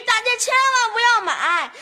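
high, drawn-out mock shout; re-enacted yelling. Child showing the family the warning, high drawn-out mock yell. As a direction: act out a long warning call. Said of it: "我就嚷嚷".

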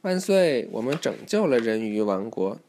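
ordinary delivery, nothing marked

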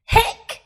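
high squeaky hiccup; a sharp little hic; hiccup sound. Acted. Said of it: "said Little Bear".

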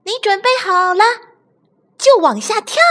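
high-pitched toys calling up. Acted. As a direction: call out in a high, urging voice. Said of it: "对小憨大声喊". (尖着嗓子大声喊)

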